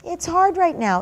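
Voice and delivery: put-on high, small kid voice admitting it's hard; child's voice. Child telling the parent it's hard right now, high kid voice. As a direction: let the little one confess it.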